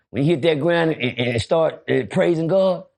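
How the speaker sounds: normal talking voice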